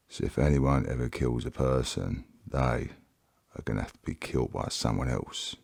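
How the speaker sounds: put-on Bible story read aloud in a Cockney accent. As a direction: tell it like a cheeky Cockney storyteller, Cockney accent throughout. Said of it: "that's a little bit of the Cockney Bible".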